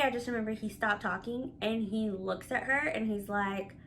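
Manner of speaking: normal talking voice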